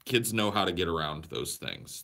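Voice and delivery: normal talking voice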